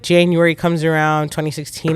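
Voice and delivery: ordinary delivery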